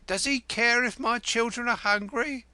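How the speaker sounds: put-on mother defending her hungry children; 1 indignant retort. A narrator's reading. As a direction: fling it back at him. Wife retorts to husband.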